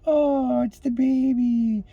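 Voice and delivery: put-on high, slow cooing over a baby. Adult cooing at a baby, tender and high. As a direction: melt at the sight of a baby. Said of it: "you're just like".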